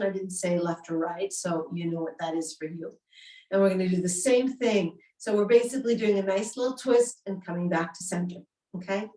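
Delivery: normal talking voice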